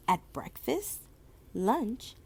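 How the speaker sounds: ordinary delivery, nothing marked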